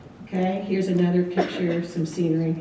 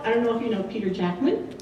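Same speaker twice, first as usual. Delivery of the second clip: ordinary delivery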